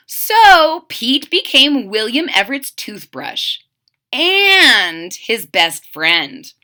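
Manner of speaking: ordinary delivery, nothing marked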